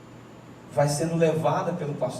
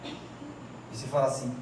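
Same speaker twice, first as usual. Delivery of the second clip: normal talking voice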